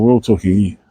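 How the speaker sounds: ordinary talk, nothing marked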